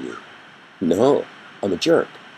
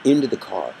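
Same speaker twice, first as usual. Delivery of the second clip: ordinary talk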